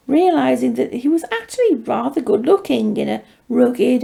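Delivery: usual speaking voice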